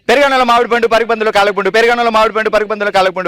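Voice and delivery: fast, run the tongue twister together. Shown as a demonstration. Host shows the guest how quick it should go, fast. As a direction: rattle off పెరుగన్నంలో మామిడి పండు at double speed. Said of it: "ఆ, fast గా".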